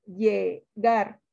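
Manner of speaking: "Llegar" split as lle, GAR; syllable-by-syllable stress demo. Shown as a demonstration. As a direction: pause inside "Llegar" and land on GAR. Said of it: "Sílaba tónica".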